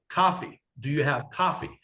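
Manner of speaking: "coffee" with an open "ah", like "cahfee"; exaggerated non-New York "coffee", open first vowel; changed-up accent. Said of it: "had to change up the accent".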